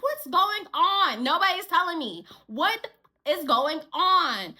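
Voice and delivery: loud, high, drawn-out question; frustrated demand. Retold or imitated. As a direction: stretch each word in exasperation. Acting out frustrated repeated demand.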